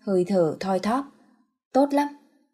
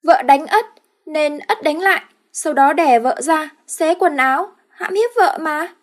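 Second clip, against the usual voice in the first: put-on high, loud childish man voice; high childlike husband's voice; simpleton husband; 8 semitones higher, 6 dB louder than usual. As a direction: play a dim-witted brute. Ất explains to father.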